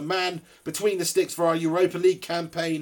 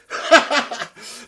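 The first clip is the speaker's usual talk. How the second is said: short unvoiced chuckle; a quick airy laugh; breathy laugh; 8 semitones higher, 5 dB louder than usual. Real, not acted. Laughing at mate's wife.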